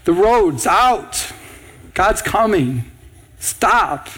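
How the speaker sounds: very slow, each phrase set apart; urgent slow warning. Pretend. Pastor urging believers to warn passers-by, slow and firm. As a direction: spell out the warning so it lands. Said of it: "stand out in front of the street and say".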